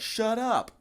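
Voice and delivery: very high, comic telling-off; mock irritation. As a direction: squeal it at a dying man, exasperated. Retelling reply to dying man.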